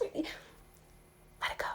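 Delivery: slow breathy whisper of release. Real, not acted. Telling the listener to release the past, in a slow whisper. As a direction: let the words fall away on breath. Releasing the old self gently.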